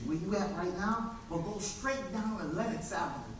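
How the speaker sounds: low, soft, confiding hustler; hushed street hustler. Acted. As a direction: let him in on a secret. Malik hooks up the newcomer.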